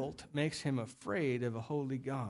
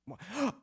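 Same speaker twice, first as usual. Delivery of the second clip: mock gasp; 4 semitones higher, 5 dB quieter than usual. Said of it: "this couple was somehow a dim bulb".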